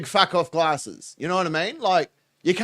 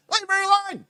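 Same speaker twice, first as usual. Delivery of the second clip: high falsetto squeal of mock protest; put-on falsetto plea; 12 semitones higher, much faster than usual. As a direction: defend her in a silly, very high voice.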